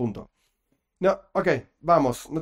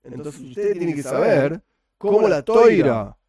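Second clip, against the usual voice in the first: lightly voiced sage laying down the law, a bit louder in places; mock Ramban speaking; 5 semitones higher, 3 dB louder than usual. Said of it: "Esto es lo que diría, diría el Ramban".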